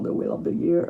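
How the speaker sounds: The Wheel of the Year in a thick French accent; French-accented English. Shown as a demonstration. Reader enjoying her own French accent on The Wheel of the Year. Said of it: "j'aime bien comment je le dis avec mon accent".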